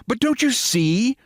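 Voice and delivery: a hint of an eager American voice; standard American accent. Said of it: "said the American lady".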